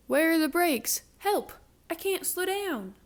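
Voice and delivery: high, loud panic; runaway cow panicking for help; panicked cry. Acted. Cows panicking, no brakes.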